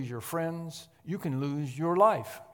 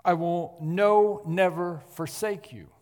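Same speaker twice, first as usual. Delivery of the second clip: preaching at full force; 3 semitones higher, 9 dB louder than usual. Acted. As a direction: proclaim it to the whole church.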